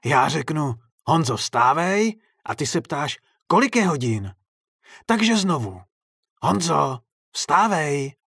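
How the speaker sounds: loud, high-pitched scolding; chiding. A narrator's reading. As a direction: explain the game's rules reproachfully. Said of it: "ozvalo se káravě".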